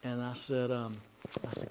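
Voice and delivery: ordinary delivery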